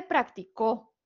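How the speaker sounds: "practicó" stressed on the last syllable, prac-ti-CÓ; stress on final O. Shown as a demonstration. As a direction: push the weight to the end of "practicó". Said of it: "el acento prosódico en la O".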